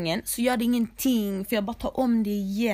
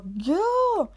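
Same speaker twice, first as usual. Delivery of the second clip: mother's command; 10 semitones higher than usual. Retold or imitated. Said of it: "Hon bara".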